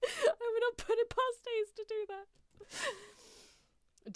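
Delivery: forcing the words out while cracking up; laughing. Real, not acted. Streamer repeating her joke, helplessly laughing.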